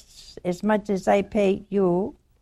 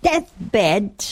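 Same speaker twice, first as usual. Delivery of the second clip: slow, loud mock indignation; getting hot; 3 semitones higher, 5 dB louder, much slower than usual. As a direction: imitate a widow working up to anger. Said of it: "boy, she's getting hot".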